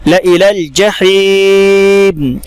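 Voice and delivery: ला इलल जहीम drawn out very slowly; Quranic recitation. Shown as a demonstration. Teacher modelling the phrase, slow recitation. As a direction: chant ला इलल जहीम, जहीम long. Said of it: "ला इलल पढ़ेंगे बगैर अलिफ के".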